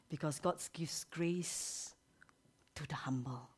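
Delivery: slow, soft whisper. Real, not acted. Preacher whispers slowly.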